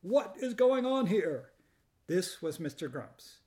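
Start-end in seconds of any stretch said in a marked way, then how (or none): 0.0-1.5 high, loud and slow outraged shout; furious shout. Acted. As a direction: bellow in fury at the scene. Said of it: "charged with fury, shout".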